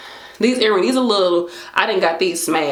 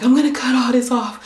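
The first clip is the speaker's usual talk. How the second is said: fed-up resolve; 6 semitones higher than usual. Retold or imitated. Retelling deciding on a bus.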